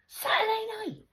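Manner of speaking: loud high outraged cry; indignant shout. Real, not acted. Fuming about a Saturday visit, indignant shout. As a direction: throw it out in outrage.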